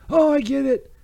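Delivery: normal talking voice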